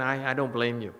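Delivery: ordinary delivery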